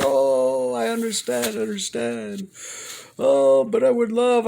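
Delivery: high, pleading father voice; imitating dad. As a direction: whine it like a guilt-tripping dad. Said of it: "He's like".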